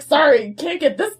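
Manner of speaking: fed up with him, hammed up for laughs; mock exasperation. Teen apologising to girlfriends about clingy crush, mock-exasperated.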